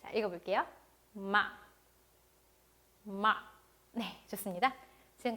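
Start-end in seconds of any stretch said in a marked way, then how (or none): 1.1-3.4 마 said low and short; brief, low 마 with a firm stop; dot-tone demo. Shown as a demonstration. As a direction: drop 마 short, like planting a dot. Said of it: "점을 딱 찍어주시면 돼요".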